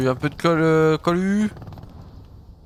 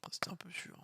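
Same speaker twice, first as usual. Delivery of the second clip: slow unvoiced whisper; 3 semitones higher, 7 dB quieter than usual. Real, not acted. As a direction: whisper it so nobody hears. Sniping quietly, hidden.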